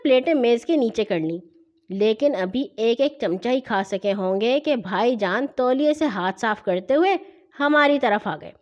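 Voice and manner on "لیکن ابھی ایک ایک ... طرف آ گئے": plain reading voice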